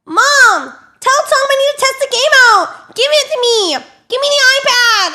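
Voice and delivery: high, loud mock kid tantrum; demanding kid. Son telling mom he wants the iPad, shrill demanding yell. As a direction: yell it like a bratty child.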